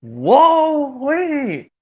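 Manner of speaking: high, alarmed cartoon cry; startled cartoon character. Cartoon character finding a snake in the food, startled. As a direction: yelp your alarm in a high voice. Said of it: "how about this impression after this one where it goes".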